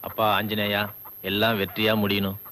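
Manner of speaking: quiet prayer. Acted. Doctor prays quietly.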